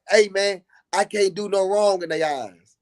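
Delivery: ordinary delivery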